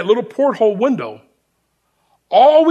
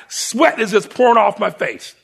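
normal talking voice

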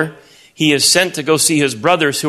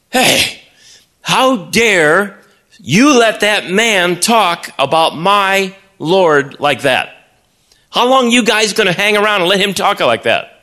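indignant shout; 6 semitones higher than usual. Acted. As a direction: call them out for letting God be mocked. David rebuking the soldiers.

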